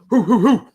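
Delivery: loud hooting mimicking a policeman's bark; loud mocking hoots; mock dog noises. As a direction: hoot it out like a man pretending to be a dog.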